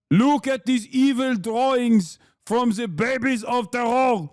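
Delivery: hammed-up accented envoy, mock outrage; Danny Danon. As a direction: parody an official raging in a put-on accent. Mocking the envoy's clip just played.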